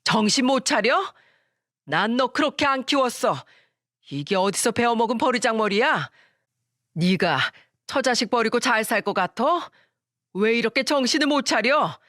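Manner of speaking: a furious mother shouting down her son; angry shout. Acted. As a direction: scold him at full voice. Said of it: "화난 목소리로 소리치셨습니다".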